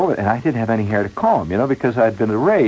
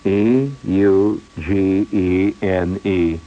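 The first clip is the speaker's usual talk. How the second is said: slow misspelling; 2 semitones lower, much slower than usual. Retold or imitated. Clerk misspells the name.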